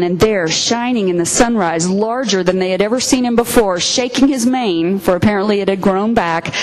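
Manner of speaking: ordinary talk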